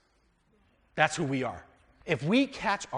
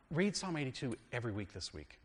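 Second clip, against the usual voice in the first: ordinary talk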